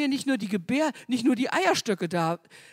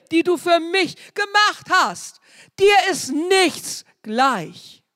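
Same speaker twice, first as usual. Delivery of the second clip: loud, high, fervent preaching that swells on the name Jesus; full-force preaching; 5 semitones higher, 8 dB louder than usual. Acted. Preacher proclaims the psalm to the congregation, fervent loud preaching. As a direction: proclaim it to the whole congregation, slowing on the key words.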